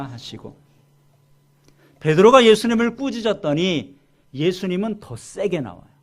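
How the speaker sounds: ordinary talk, nothing marked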